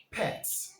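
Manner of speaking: pets with a clipped short eh vowel, pets; pets, vowel eh held clear; model word. Shown as a demonstration. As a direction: say "pets" slowly and cleanly for pupils to copy. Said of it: "Listen and repeat after me".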